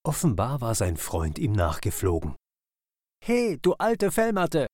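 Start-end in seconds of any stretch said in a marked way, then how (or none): 3.2-4.7 much higher bird voice calling out teasingly; grinning call. Acted. Kingfisher greets his otter friend, high teasing call. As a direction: tease your friend with a grin in your chirpy voice. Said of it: "rief der Eisvogel grinsend".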